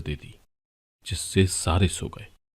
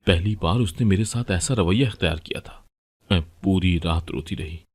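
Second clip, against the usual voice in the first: ordinary delivery, nothing marked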